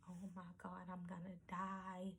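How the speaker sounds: whispered terrified "I'm gonna die"; hushed and scared, barely voiced; frightened murmur under her breath; panicked whisper. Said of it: "this is what I'm saying".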